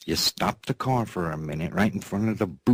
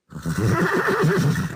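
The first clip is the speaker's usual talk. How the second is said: high giggle; 4 dB louder than usual. Real, not acted.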